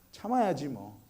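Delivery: low, hushed imitated reply; resigned child. Older son answering dad, quiet resignation. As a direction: give in quietly, under your voice.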